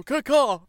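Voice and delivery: loud crow caw; bird call. Acted. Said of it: "you go".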